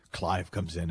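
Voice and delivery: normal talking voice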